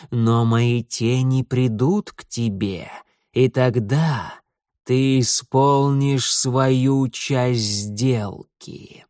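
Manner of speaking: very slow, ominous lord of darkness; dark lord. Acted. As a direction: intone it slowly, like a dark god. Setil's dark speech.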